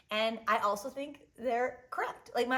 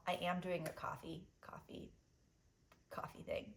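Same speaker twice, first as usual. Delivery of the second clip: whispered secret; 4 semitones lower, 12 dB quieter than usual. Pretend. Teasing coffee news secretively.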